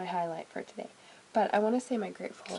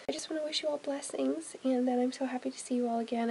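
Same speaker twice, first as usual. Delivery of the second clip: ordinary talk, nothing marked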